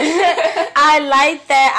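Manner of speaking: laughing through the words. Real, not acted. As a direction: giggle it out, high and slow. Laughing while reacting to song.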